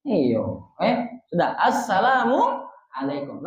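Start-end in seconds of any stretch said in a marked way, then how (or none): 1.6-3.4 Assalamualaikum said slowly in one unbroken breath; slow connected demo. Shown as a demonstration. Teacher shows joined greeting, slow and unbroken. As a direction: join Assalamualaikum smoothly, no gap.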